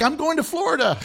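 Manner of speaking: high, giddy announcement; mock giddy. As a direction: toss it off like a new adventure. Friend's next restless plan.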